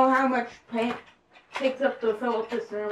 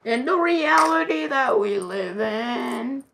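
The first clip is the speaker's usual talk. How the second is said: slow, stretched-out chant; playful intoning; slower than usual. Pretend. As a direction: draw the words out theatrically. Child intones a meme line.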